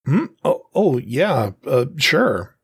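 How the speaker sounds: nervous, stumbling, pitch jumping up; fail to sound calm, stammering; flustered stammer. Acted. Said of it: "trying to sound calm but failing miserably".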